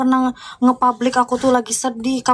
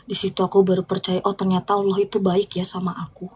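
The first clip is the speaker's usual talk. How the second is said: hushed, softer confession; sincere whisper; 4 semitones lower, 5 dB quieter than usual. Real, not acted. Sharing with viewers how she came to believe, hushed sincere whisper. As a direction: let it drop to a near whisper.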